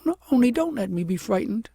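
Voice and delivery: put-on a girl begging not to be scared; pleading princess. A narrator's reading.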